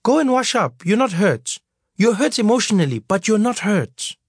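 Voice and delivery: mother brushing off her crying son, firm; brisk no-nonsense mother. Acted. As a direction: shoo him off to wash, amused. Mother dismisses son's tears.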